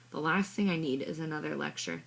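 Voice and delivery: ordinary delivery, nothing marked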